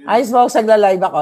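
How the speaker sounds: telling a friend she's live; calling out. Real, not acted. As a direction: call across the room mid-stream. Calling out to Spokes off-camera.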